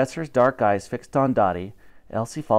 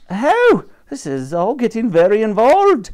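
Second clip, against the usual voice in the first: cleaning lady grumble; 8 semitones higher, 7 dB louder than usual. Acted. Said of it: "muttered Dottie".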